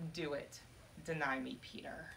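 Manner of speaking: low, soft, sinking challenge; hushed dare. Acted. Lady Jesus dares Peter to deny her, in a sinking hush. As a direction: let it fall away almost to nothing.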